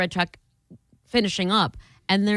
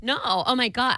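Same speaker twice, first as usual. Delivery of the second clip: ordinary delivery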